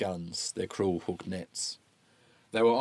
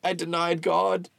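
tearful; 8 semitones higher than usual. Acted. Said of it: "He began to cry".

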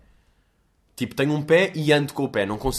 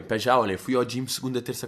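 usual speaking voice

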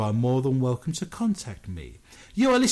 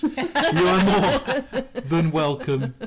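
laughing; 5 semitones higher, 5 dB louder, much slower than usual. Real, not acted. Laughing invitation.

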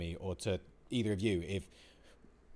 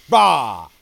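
short, very loud venting yell; sudden bark of pent-up steam; frustrated roar; 5 semitones higher, 21 dB louder than usual. Retold or imitated.

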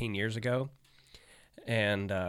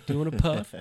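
higher, laid-back buddy greeting; Craig's greeting; 6 semitones higher, 5 dB louder, faster than usual. Retold or imitated. Imitating Craig offering to smoke, lightly higher and easygoing. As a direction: invite them to smoke, easygoing. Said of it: "that was always his thing".

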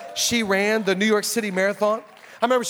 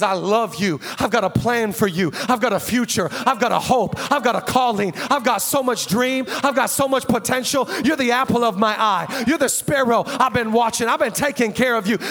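full-force preaching; 2 semitones higher, 5 dB louder than usual. Real, not acted. Pastor voicing God's promises to church, loud building preaching. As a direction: pile up each promise, rising to a big finish.

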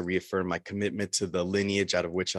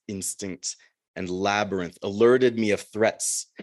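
steady, louder poem reading voice; poetry reading; 3 semitones higher, 6 dB louder than usual. Acted. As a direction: read the poem to the room. Continues Minotaur poem.

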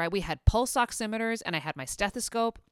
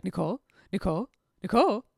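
mock anxious calling; faster than usual. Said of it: "like a little duckling".

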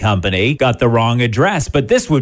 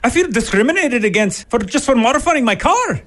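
leprechaun Irish, pitched high; hammed-up Irish lilt, much higher; mock Irish accent; 11 semitones higher than usual. Host retries the car owner in a mock Irish accent. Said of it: "That was a weird accent".

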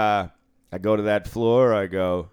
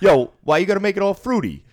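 a hint of a pushy gambler, higher and louder; mock gangster gambler; 10 semitones higher, 8 dB louder, faster than usual. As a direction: play the offended tough guy with mock outrage. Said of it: "And he goes".

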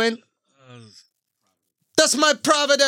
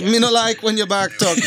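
playful Jamaican patois scolding; Jamaican accent; 5 semitones lower than usual. Pretend. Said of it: "Jamaican accent".

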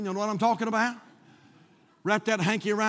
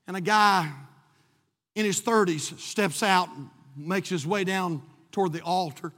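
ordinary delivery

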